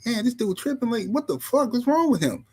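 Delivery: high-pitched, mildly exasperated griping; mad retold. Twelve-year-old griping about his father, mildly mad. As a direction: grumble like a baffled, annoyed kid. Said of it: "I was so mad at my father".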